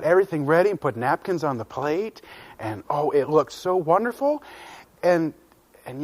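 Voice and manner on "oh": plain reading voice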